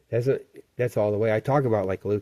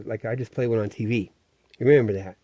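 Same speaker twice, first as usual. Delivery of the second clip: normal talking voice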